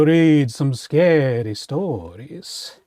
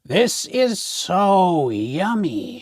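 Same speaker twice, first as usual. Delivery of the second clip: Big Witch; much slower than usual. Acted. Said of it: "Big Witch took a taste".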